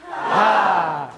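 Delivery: sharp breath out, "Ha!"; forceful exhale. Shown as a demonstration. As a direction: push the breath out.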